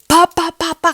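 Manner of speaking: very loud, high pa pa; loud footstep sounds. Shown as a demonstration. Imitating crazy steps at night, loud stomps. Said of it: "crazy steps".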